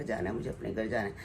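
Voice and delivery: low, soft plaintive repeat of mother's wish; a hint of an ailing mother pleading to go home; imitating mother. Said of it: "यही कह रही थी कि".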